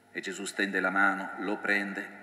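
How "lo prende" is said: usual speaking voice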